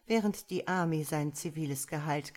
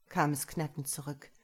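ordinary delivery

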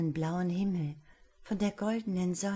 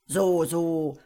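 grumbling wizard; 9 dB louder than usual. Acted. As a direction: growl it out like a gruff old magician. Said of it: "brummte der Zauberer".

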